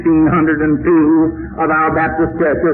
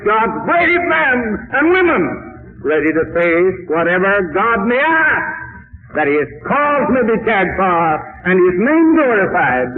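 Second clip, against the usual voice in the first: rousing preaching; 4 semitones higher than usual. Acted. Preacher hailing God's brave men and women, rousing. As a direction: proclaim it like a rallying call.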